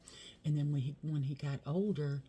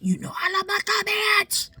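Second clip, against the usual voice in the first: very high, louder cartoonish squeal; squeaky playful voice; 10 dB louder than usual. Real, not acted. Playful squeaky plea to viewers.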